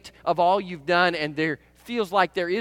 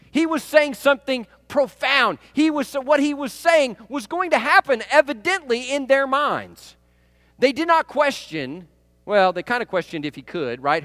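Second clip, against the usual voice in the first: ordinary delivery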